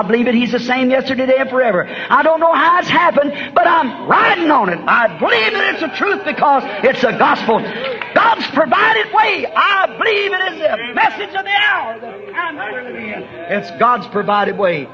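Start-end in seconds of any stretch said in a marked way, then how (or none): 2.1-7.6 high, ringing pulpit cry; fiery preaching. Acted. Preaching climax, pitch way up. 8.1-9.5 high, slow, shouted refrain; God's provided way cried out at the peak of the sermon; preacher's shout. Acted. 9.5-13.2 from a high cry down to a hush; preaching high then fading. Acted.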